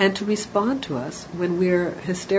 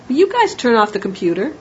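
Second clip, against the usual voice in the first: louder, higher, mildly irritated request; a little irritated; 4 semitones higher, 7 dB louder than usual. Acted. Parent asking the kids once more, a little irritated. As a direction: let some irritation creep into the nagging. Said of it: "getting a little bit more irritated".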